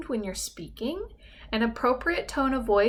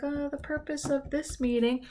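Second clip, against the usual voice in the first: mumbled, looking down; 4 semitones higher than usual. Shown as a demonstration. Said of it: "looking down and I was like".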